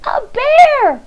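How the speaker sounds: high, loud frightened scream; a boy screaming at a giant bear. Acted. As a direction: shriek it in terror. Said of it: "screamed Eddie".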